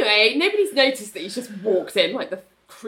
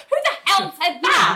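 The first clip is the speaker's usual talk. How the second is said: incredulous; 8 semitones higher than usual. Retold or imitated. Renee's quote, incredulous.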